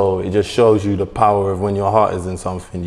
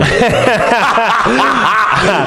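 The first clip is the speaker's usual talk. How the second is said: bursting into a high, loud laugh; cracking up at a friend; 12 semitones higher, 6 dB louder than usual. Real, not acted. Laughing at friend's old man line.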